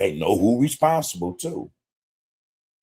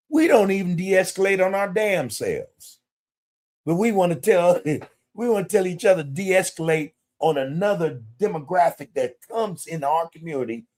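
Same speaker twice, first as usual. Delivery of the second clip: indignant rant; 8 semitones higher than usual. Real, not acted. Indignant rant rising high.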